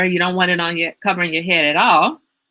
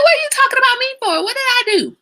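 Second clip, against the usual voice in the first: much higher, fast, put-on indignant mother; mom voice; 5 dB louder, faster than usual. Daughter imitating her mother's indignant question in a squeaky high voice. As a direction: squeak out mom's offended protest. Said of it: "She's like".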